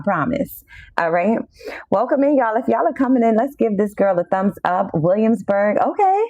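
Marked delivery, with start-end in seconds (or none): 5.8-6.2 excited. Real, not acted. Hyped at Williamsburg viewer.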